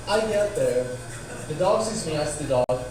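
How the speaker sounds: ordinary talk, nothing marked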